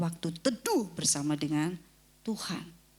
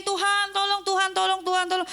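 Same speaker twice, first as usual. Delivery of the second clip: high, louder nagging plea; mock repeated pleading; 12 semitones higher, 6 dB louder than usual. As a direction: imitate begging over and over. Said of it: "Tapi kemudian". (meniru doa minta tolong berulang-ulang)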